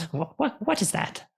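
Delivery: high, stammering alarm; nervous high-pitched whimper; frightened creature. Acted. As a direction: stammer it in a small scared voice. Creature sees tentacle enter.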